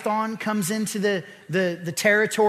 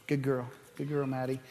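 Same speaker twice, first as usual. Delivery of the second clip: soft praise; 7 semitones lower, 11 dB quieter, faster than usual. Real, not acted. Praising the animal softly.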